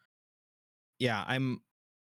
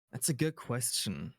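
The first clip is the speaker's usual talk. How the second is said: normal talking voice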